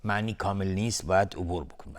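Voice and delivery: ordinary talk, nothing marked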